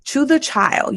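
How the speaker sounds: usual speaking voice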